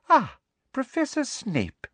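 sweet, false welcome; Umbridge's voice. A narrator's reading. Umbridge greets Snape, high and falsely sweet.

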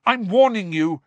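high, sharp warning. Acted. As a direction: threaten him. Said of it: "said Harry".

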